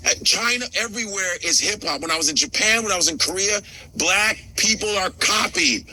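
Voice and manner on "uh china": ordinary talk, nothing marked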